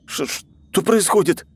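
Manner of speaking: high, slow panicky cry; panicked exclaim. A narrator's reading. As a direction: start to panic, stammering. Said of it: "воскликнул он, начиная паниковать".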